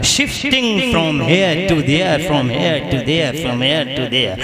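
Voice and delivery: very slow, stretched English phrase; drawn-out repetition. Real, not acted. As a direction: draw out each repeat for rhetorical effect. English phrase repeated for effect. (धीमे, खींच-खींचकर दोहराते हुए)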